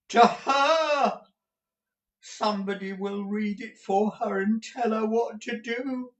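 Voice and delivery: put-on high-pitched old miser's voice; frantic old man, pitch held high; distraught raving. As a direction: rave on in panic, heedless of the listener. Gride raves on, not hearing Ralph.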